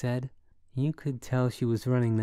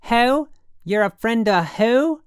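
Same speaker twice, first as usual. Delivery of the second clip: high, loud, incredulous woman; near yelling; 12 semitones higher, 9 dB louder, slower than usual. A narrator's reading. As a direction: tigress screeching down the phone. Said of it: "She was damn near yelling".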